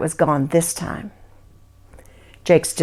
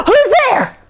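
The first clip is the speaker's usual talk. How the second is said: high, loud startled cry; gasp, then call out scared; frightened gasp; 6 dB louder than usual. Acted. Jake startled in the dark.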